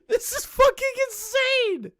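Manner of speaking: high-pitched cry of disbelief; incredulous squeal. Real, not acted. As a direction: squeal it in amazement. Amazed outburst at the story.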